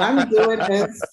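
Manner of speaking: giggly mock announcement; laughing showpiece. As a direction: ham it up while cracking up. Laughing, acting out the hand motions.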